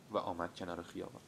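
ordinary delivery

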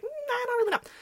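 high-pitched mock-toddler voice; small high child voice; unsure toddler. Voicing the toddler a second time.

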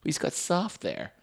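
soft, slow sappy sigh; mock sentimental. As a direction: coo it like a sappy eulogy. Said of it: "everyone's smile lights up a room".

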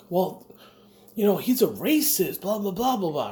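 high-pitched mocking imitation of a complainer; mock complaint. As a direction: parody their griping, pitched well up. Mocking retold political complaint.

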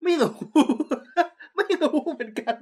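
high-pitched, laughing admission of cluelessness. Real, not acted. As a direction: burst out laughing as you admit it. Laughing, clueless about the chairs. (หัวเราะเสียงสูง)

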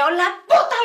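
high, loud mock shout; insistent wife. Señora insisting he choose, mock shouted ultimatum. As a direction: imitate a stubborn woman yelling. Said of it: "empezó bien necia la señora de que".

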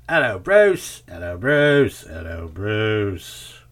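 hammed-up accent calling out Bruce; comic accent. Quoting the song's Bruce line in the accent. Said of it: "had to say it in the accent".

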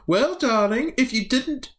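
high, sing-song mother voice; mother answering her child from upstairs; alien mother. Acted. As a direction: call down like a patient mum. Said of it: "from upstairs you hear".

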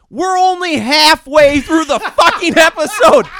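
high, loud viewer's disbelief, breaking into laughter; astonished outburst. Retold or imitated. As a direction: shout it in amazed mock outrage.

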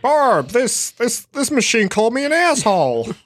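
high, indignant put-on movie quote; Stephen King cameo. Man at the ATM telling his wife, mock indignant impression. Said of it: "Stephen King makes a cameo".

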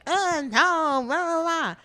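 mock dismissive rejection; mock brush-off. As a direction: imitate someone waving off a suitor. Mimics women brushing men off.

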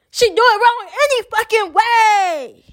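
high-pitched exasperated cry; exasperated outburst. Real, not acted. Speaker fed up with girl's wrong cadence, high exasperated outburst. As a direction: burst out high, stretching the last word.

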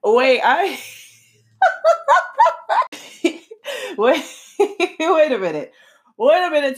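high, laughing disbelief. Real, not acted. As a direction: break off into giggles and stop the reading. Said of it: "but I'm laughing".

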